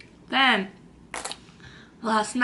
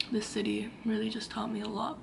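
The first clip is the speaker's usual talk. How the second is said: soft whispered reflection; reflective whisper; 2 semitones lower, 12 dB quieter than usual. Real, not acted. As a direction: let it fall to a whisper, thoughtful.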